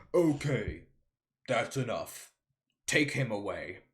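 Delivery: put-on judge voice; Judge Bumbleton. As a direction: call a halt from the bench. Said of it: "the Judge Bumbleton voice I've made".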